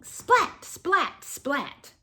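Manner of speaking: lightning splat. Pretend. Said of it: "makes thunder".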